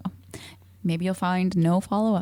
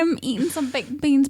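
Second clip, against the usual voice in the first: usual speaking voice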